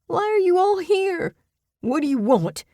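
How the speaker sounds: very high, loud frantic cry; panicked shout. A narrator's reading. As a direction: shriek at the people closing in. Clem panics at the crowd.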